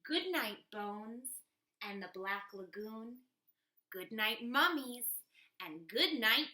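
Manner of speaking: usual speaking voice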